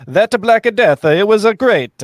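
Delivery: mock Italian accent, high and loud. Shown as a demonstration. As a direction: lay on a thick Italian accent. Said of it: "can you do a good Italian accent?".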